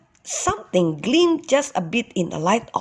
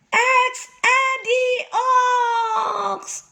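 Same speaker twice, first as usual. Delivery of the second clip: high, slow, drawn-out holler; a boy testing the echo with a holler; much slower than usual. Acted. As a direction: call his own name into the cave. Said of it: "hollered X Eddie Ox".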